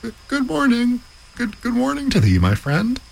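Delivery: very high, sing-song calling from inside a sack; a trapped man hailing a passing student; cried out. Acted. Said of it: "he cried out".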